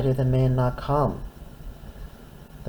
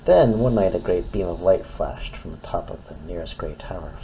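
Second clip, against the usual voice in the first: usual speaking voice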